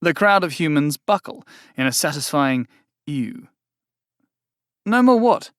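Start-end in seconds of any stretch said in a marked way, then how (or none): 4.8-5.5 high, loud puzzled demand; a demon thrown off; baffled fiend. Acted.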